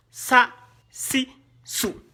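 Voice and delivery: "sa", "si", "sou" as short separate syllables; quick short vowels with gaps: "sa", "si", "sou"; brief vowels. Shown as a demonstration. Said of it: "C'est bref, non".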